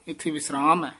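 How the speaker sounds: normal talking voice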